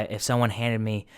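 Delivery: normal talking voice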